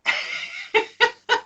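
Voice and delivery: high burst of laughter; high bright laugh; real laugh. Real, not acted. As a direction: crack up laughing.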